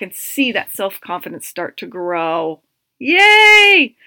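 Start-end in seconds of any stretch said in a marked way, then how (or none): 3.0-3.9 high, loud cheer; happy cheer. Real, not acted. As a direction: throw out a big cheerful yay. Cheering at the end.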